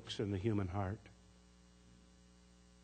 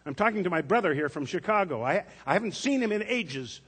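higher, louder irritated pharmacist; impatient, busy and put out; annoyed; 7 semitones higher, 5 dB louder than usual. A narrator's reading. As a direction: pharmacist waving the girl off, annoyed. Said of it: "in an annoyed tone of voice".

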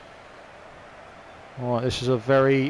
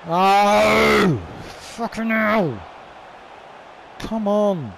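frustrated shout; 5 semitones higher, much slower than usual. Real, not acted. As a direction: let the frustration explode. Frustrated outburst.

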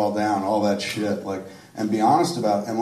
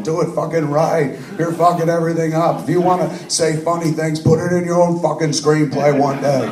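higher, louder retold chewing-out; hammed-up director's rant at an actor; mock berating; 7 semitones higher, 6 dB louder than usual. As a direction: scold the actor like a tyrant director. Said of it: "who I've just been berating".

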